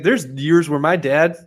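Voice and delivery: mock Chael bravado. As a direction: drag it out like a humblebrag. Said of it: "He's like".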